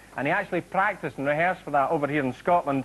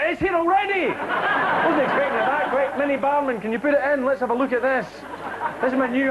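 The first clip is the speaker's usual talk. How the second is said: excited; 8 semitones higher than usual. Real, not acted. Excited at advert arriving.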